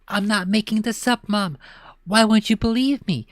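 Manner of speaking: high whining plea; pleading kid. Acted. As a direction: beg to be believed.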